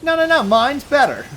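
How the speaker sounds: very high, loud mock bickering; competitive protest. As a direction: outdo your rival in an excited squeal. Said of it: "it was basically competing".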